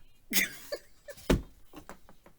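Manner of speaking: high, airy silent laugh; breathy high laugh. Real, not acted. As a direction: wheeze with laughter. Laughing at her own honesty.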